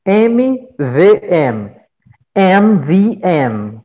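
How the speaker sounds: "M V M" spelled out slowly, letter by letter; spelling out letters. Shown as a demonstration. Teacher spelling a company's initials for the class, slow and deliberate. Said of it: "por exemplo".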